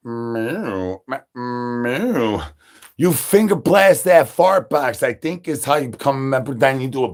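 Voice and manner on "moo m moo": lower, softer cow moo; a lightly voiced bull mooing; mock cow moo. Bull answers the call.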